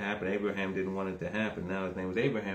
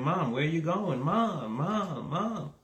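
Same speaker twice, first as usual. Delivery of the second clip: put-on higher-pitched boy calling after his mom; crying child; 8 semitones higher, slower than usual. Ishmael calling after his mother as she leaves, mock crying child. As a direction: imitate a child's repeated plaintive calls. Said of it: "Ishmael's crying too".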